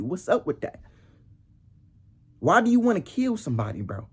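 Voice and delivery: ordinary delivery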